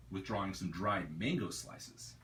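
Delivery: usual speaking voice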